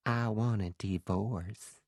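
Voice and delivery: slow, purring Southern drawl; sexy sultry Southern accent. A narrator's reading. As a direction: purr it like a seductive Southern belle. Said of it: "a sexy, sultry southern accent".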